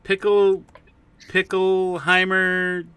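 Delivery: silly name voice. Pretend. Trying out silly names.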